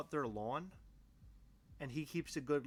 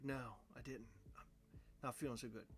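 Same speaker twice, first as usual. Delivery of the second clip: weak sick voice; 5 semitones lower, 14 dB quieter, faster than usual. Retold or imitated. Retelling being sick, answering questions.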